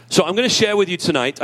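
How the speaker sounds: ordinary talk, nothing marked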